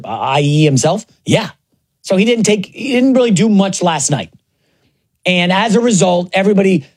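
usual speaking voice

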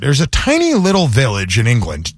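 normal talking voice

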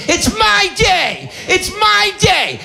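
high, slow bratty bride shout: "It's MY day!"; mock bridezilla shrieking "my"; shrill bride tantrum. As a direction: whine it like a spoiled bride, stressing my. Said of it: "the girls always say the same thing".

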